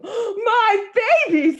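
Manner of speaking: high, slow alarmed cry of a mother; frantic mother. Acted. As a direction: shriek it out as a panicked mom. Missus Lane frantic at her baby.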